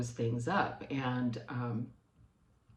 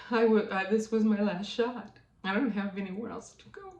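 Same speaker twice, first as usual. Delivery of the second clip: tearful; 7 semitones higher, 4 dB louder than usual. Real, not acted. To the viewers, reliving her last hope, tearful. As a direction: voice pitched up and strained, close to crying. Retelling the doctor visit, near tears.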